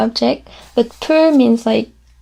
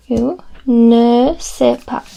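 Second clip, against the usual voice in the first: ne sais pas drawn out slowly; slow it right down; slow teaching; 3 dB louder, much slower than usual. Shown as a demonstration. Teacher models each word slowly. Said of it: "slow teaching demo".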